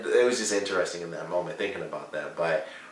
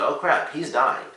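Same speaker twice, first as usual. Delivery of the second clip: mock alarm; 7 semitones higher, 3 dB louder, slower than usual. Retelling own shocked reaction.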